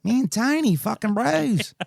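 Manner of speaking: gushing. Real, not acted. Talking about his buddy Tiny, high laughing excitement. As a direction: squeal out the love for Tiny.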